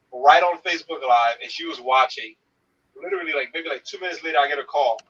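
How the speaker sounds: usual speaking voice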